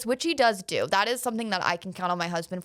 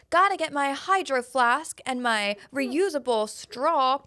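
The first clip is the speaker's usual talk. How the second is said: slow, higher Gen Z American parody; mocking American accent; 5 semitones higher, slower than usual. Scottish kids making fun of Gen Z Americans, mock American accent. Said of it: "they put on this American accent, and they make fun of Gen Z Americans".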